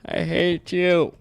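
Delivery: high, slow, hammed-up betrayal; overacted wounded friend, drawn out; mock dramatic. Mock betrayal speech to mob.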